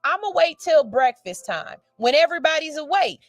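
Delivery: ordinary delivery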